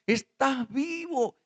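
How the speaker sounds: high, drawn-out joyful exclamation; amazed joy. Acted. Mary rushes to embrace the risen Jesus, overjoyed. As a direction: cry it out slowly in amazed joy.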